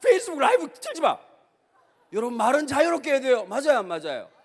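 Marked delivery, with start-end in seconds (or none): 0.0-1.2 high, loud mocking quote of the complaint. Preacher mimics the people who complained about him, high and loud mock scolding. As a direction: parody the scolding email. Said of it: "나보고 공개 사과를 하라 그래가지고".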